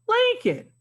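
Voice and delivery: a high bright burst on Lincoln; teacher eagerly answering her own question; excited answer. Acted. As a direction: announce the answer with delight.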